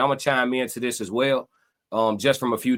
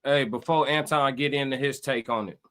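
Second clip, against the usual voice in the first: ordinary talk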